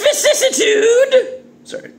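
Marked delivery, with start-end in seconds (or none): none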